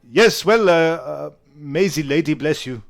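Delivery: put-on formal French-accented blessing; courteous, halting French accent; French-accented knight. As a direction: bid farewell as a French knight. GM voices the departing knight.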